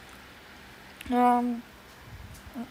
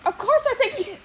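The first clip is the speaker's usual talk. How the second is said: high, laughing through the words; 13 semitones higher, 3 dB louder than usual. Retold or imitated. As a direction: crack up while saying it. Repeating the line while laughing.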